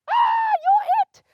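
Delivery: high, loud shout of you're it; gleeful shout. Retold or imitated. Kid yelling at a friend who is it, loud and high. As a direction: yell it out as the kid who got caught. Kids' counting game, tagged.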